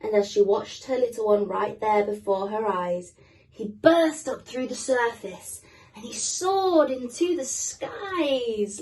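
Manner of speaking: put-on gentle read-aloud for kids; story narration. A narrator's reading. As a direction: read it like a bedtime picture book. Reading a picture book to children.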